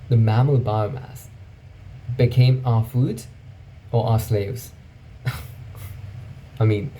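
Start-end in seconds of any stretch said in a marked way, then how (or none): 5.2-6.3 soft, voiceless huff of a laugh; pained laugh. Real, not acted. Speaker reacting to a grim mammal statistic, soft rueful laugh. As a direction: laugh quietly so as not to cry. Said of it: "I'm laughing".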